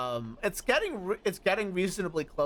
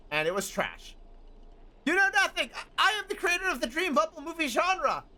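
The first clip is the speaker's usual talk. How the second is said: indignant, raised self-important rant; ranting director voice; 7 semitones higher than usual. Acted. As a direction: rant like an insulted auteur.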